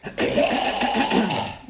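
long acted throat-clearing. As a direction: clear the throat noisily for effect. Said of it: "The sound of someone clearing their throat".

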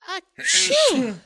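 loud, high acted sneeze; cartoon achoo, full force; big sneeze. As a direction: let out a hammed-up dragon sneeze. Feathers make the dragon sneezy.

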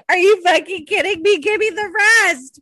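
high, loud playful outrage; squealing mock indignation. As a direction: demand the rest of the gift. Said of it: "I was like".

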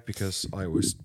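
low rough hungover mutter. Real, not acted. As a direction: sound hungover and miserable. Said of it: "you sound so miserable today".

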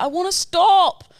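high, slow, loud pleading; whiny plea to stop. Pretend. Pleading with Ned during a run, loud and high. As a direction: beg to quit, high and drawn out. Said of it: "and I'll just be like".

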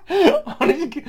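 high burst of laughter; laughing hard. Real, not acted. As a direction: laugh it out loud. Big laugh at forgetting.

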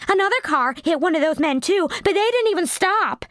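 indignant, tell on them; indignant witness. Acted. The chunky girl reporting to the cops, high and indignant.

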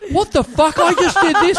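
high-pitched imitation of indignant disbelief; retold indignant outburst; mock outrage. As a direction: protest it, half laughing. Retelling Tommy's outraged reaction.

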